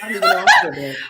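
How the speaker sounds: very high, laughing it out; embarrassed laughing squeal. Real, not acted. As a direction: squeal the words through laughter. Laughing at own slip.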